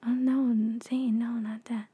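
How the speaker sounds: hushed whispered warning; whispering. Retold or imitated. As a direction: whisper it, urging him to stop. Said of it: "So he'd say".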